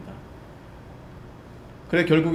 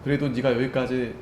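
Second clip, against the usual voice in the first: much softer, lower consoling words; tender reassurance; 5 semitones lower, 6 dB quieter than usual. Retold or imitated. Jesus comforting the ashamed Peter, quiet and tender. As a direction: let him know he's still loved. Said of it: "위로와 연민의".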